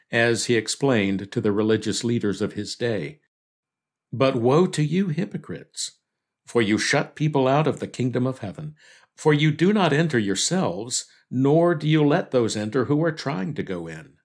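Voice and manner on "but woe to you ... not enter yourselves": normal talking voice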